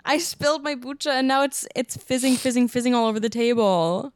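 high, crying wail over a spill; wailing in dismay. Real, not acted. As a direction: cry out in real dismay. Spilled her kombucha on table.

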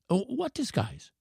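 put-on high, soft child's voice; curious boy. A narrator's reading. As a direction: ask as an intrigued little boy. Boy questions the professor's disguise.

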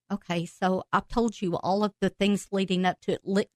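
ordinary talk

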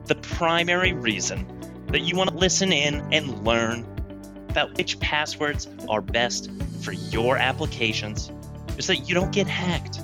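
usual speaking voice

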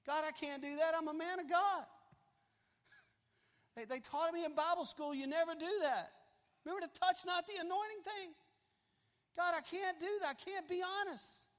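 high, loud mock pleading with God; hammed-up protest.

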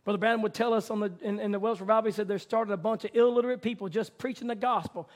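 loud, driving revival preaching; preaching at full force. Acted. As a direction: build the crowd up, full voice. Climax of the sermon.

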